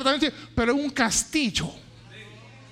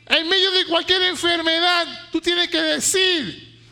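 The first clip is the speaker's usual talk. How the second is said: loud, higher fervent preacher shouts; fiery sermon climax; preaching at full force; 6 semitones higher, 4 dB louder, slower than usual. Acted. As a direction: thunder it to the congregation.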